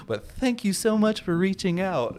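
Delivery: mock sweet sign-off hiding contempt; passive aggressive. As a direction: smile through it while meaning the opposite. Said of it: "the most passive aggressive".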